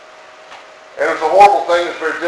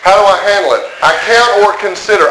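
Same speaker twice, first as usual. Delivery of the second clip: ordinary talk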